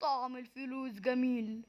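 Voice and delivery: very low, slow, dragged out. Acted. Savoring the money in a slowed, dropped voice. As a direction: savor each word, slowed and soft.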